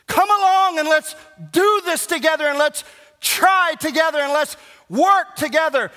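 ordinary talk

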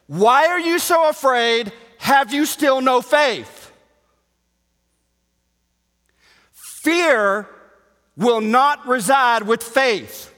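high, loud, slow pulpit cry; fervent sermon delivery; impassioned preaching. Acted. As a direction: drive the question home. Preaching at full force.

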